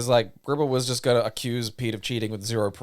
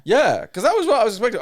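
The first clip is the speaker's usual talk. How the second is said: 1 excited; 10 semitones higher, 7 dB louder, faster than usual. Real, not acted. Excited about demon horse.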